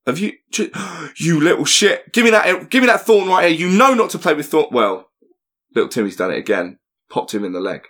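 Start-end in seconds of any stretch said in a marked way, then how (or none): 0.1-4.7 furious dad. Acted. Daddy shouting at Timmy for the thorn, high angry yell. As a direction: tear into the kid like an enraged dad. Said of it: "He's gone".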